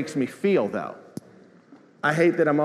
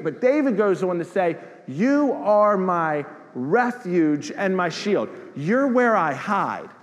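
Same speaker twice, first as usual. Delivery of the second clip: full-force preaching; 6 semitones higher than usual. Acted. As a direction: drive every line home to the congregation.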